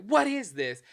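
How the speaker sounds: imitating a rude customer's scornful what is this; rude disgusted tone. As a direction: sneer it like she's insulted. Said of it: "I mean in that tone".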